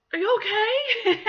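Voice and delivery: loud, high, drawn-out question, half laughing; startled concern. Retold or imitated. Retells startled question to panicking woman.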